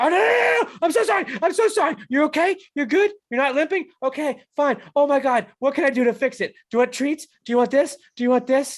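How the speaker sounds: high-pitched loud frantic concern; hammed-up squeaky apologetic babying; panicked fussing. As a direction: fuss anxiously over a hurt pet. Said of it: "and I was just like".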